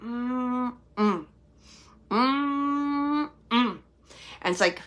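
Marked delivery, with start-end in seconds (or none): 0.0-3.3 long held hum, then a short drop; disapproving "mm-mm". Retold or imitated. Medium mimicking spirit's reproach, disapproving hum. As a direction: hum a drawn-out no. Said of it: "saying it just like that".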